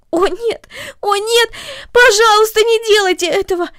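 put-on high, loud, panicked pleading girl; terrified girl crying out to the mother; frightened cry. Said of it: "закричала Корнели".

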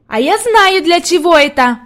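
put-on high, loud, eager child's outburst; exclaiming little girl. A narrator's reading. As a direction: pipe up excitedly as a little girl who knows the answer. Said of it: "воскликнула Хади".

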